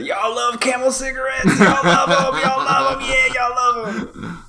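high mocking voice, drawn out; mock impression. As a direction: tease in a high sing-song mimic. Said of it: "pulled out one of those Camel cigarettes and said".

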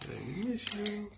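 put-on soft high prompt for a toddler; feed the line gently for a child to repeat; teaching a parrot. Shown as a demonstration.

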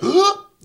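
sudden high, loud intake of breath; sharp gasp. Retold or imitated. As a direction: gasp as if out of air. Acts out Sam running out of breath.

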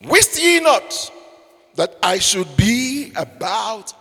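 slow solemn quote. Acted. Jesus quote read slowly, high.

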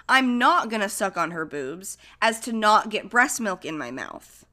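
ordinary talk

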